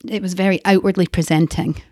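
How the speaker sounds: normal talking voice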